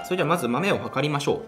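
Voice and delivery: ordinary talk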